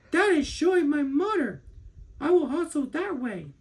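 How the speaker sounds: a small bear sure he's found his mother; little bear cub's voice. A narrator's reading.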